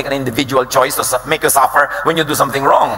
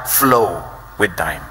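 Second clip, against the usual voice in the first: drawn-out slow; 7 semitones lower, 3 dB quieter, much slower than usual. Real, not acted. Preacher stressing key advice.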